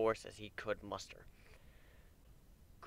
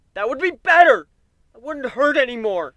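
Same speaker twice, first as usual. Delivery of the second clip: much higher, full-volume anguished yell; desperate scream; 16 dB louder than usual. A narrator's reading. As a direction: scream it to drown her out. Warren screams, hooves over ears.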